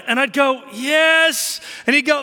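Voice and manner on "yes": loud, raised-pitch dad reply; mock exasperation on one word; exasperated answer. Dad's exasperated repeated answer.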